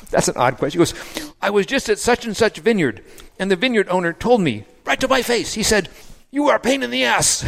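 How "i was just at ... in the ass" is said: put-on high, excited French-accented retelling; French accent, breathless and high. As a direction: play the worked-up Frenchman, French accent, much higher. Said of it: "with his French accent".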